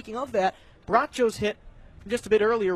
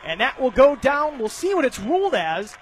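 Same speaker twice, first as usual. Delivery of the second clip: lively baseball play-by-play, raised and quick; 5 semitones higher, 6 dB louder than usual. Real, not acted. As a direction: call the hit for the radio crowd. Live play-by-play call.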